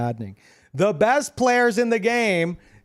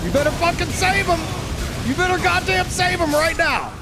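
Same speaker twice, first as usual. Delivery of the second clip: angry shout; 12 semitones higher than usual. Real, not acted. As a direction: scream it at the players ganking you. Yelling at enemies in boss fight.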